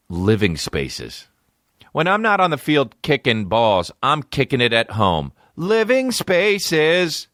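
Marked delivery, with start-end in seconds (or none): 1.9-5.3 higher-pitched parody of a TV spot; mock ad read. Soccer star endorsing Living Spaces, mock ad read. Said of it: "Do an ad for fucking". 5.6-7.3 high, drawn-out slogan sign-off; mock ad tagline. Soccer star signing off a furniture ad, mock tagline. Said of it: "Do an ad for fucking".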